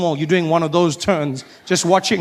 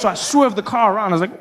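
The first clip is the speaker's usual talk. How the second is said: ordinary talk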